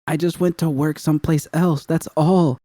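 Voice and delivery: high, pleading plea. A narrator's reading. Roxanne pleading with the gang boss, high and desperate. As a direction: beg him to understand. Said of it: "Roxanne pleaded".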